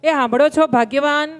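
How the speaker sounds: calling out. Retold or imitated. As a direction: call for the wife from the dinner seat. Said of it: "બૂમ પાડી".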